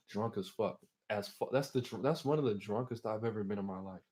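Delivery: normal talking voice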